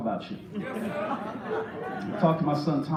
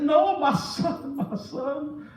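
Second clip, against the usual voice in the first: mock crying wail; 9 semitones higher, much slower than usual. Mother confiding in the preacher about her son, mock crying wail. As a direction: imitate a sobbing mother, stretching the words. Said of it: "She's crying".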